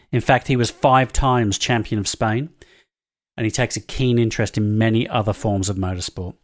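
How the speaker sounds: scripted reveal read. Acted. Reads scripted mystery-guest bio.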